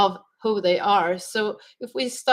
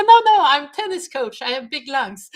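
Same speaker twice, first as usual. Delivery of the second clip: laughing retort; 6 semitones higher, 6 dB louder than usual. Retold or imitated. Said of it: "I said".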